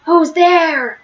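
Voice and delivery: plain reading voice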